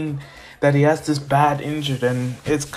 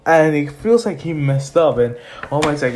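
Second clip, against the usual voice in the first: ordinary delivery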